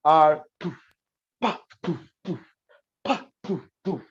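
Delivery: mouthed kick and snare sounds, short and percussive; beatbox a simple reggae drum pattern; vocal drum beat. Shown as a demonstration. A drummer shows a simple groove he could post. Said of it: "Even if I just play".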